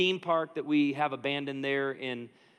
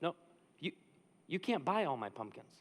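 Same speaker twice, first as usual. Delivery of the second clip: halting, softer stammer of disbelief; shocked; 6 dB quieter than usual. Acted. Young seller refusing pumpkin buyer, mock shocked. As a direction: stammer it out, mildly stunned. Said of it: "this shocked expression".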